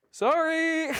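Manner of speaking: high, drawn-out mock apology; sing-song sorry after a blunder; sheepish sorry. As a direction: SDF soldier apologizing to everyone, sheepish. SDF guy apologizing for RPG.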